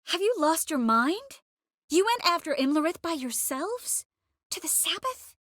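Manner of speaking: high-pitched incredulous questioning; appalled disbelief. Acted. Sorceress learns they went after Imlerith alone, incredulous. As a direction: voice leaps up in disbelief. Scolding companions for a reckless hunt.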